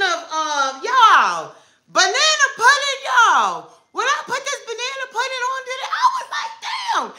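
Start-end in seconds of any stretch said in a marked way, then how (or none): 1.9-3.7 high, thrilled product hype; excited squeal. Real, not acted. 3.9-7.1 high laughing exclamation; laughing excited. Retold or imitated. Retelling first try, laughing.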